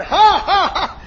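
big, high mock guffaw; loud put-on "ha, ha, ha"; imitated mocking laugh. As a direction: imitate a man rearing back to laugh. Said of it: "he just broke out and laughed".

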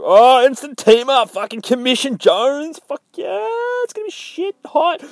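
a hyped fan squeaking with delight; excited fanboy squeal. Retold or imitated. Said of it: "and then we go".

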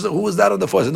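ordinary delivery

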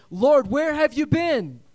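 high, loud pleading cry; frustrated cry to God. Retold or imitated. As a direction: call out to the Lord in exasperation. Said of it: "You're going".

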